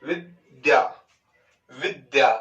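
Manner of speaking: विद्या said as विद्-द्या with a short stop before the द; doubled द. Shown as a demonstration. Teacher shows the students how to say it: विद्या with the द doubled. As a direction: lean on the द in विद्या so it sounds twice. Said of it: "बोलोगे आप विद्या".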